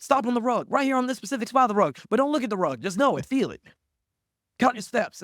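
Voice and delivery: high, loud bossy instructions; stage director orders. Retold or imitated. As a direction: bark orders at the contestant. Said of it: "they's like".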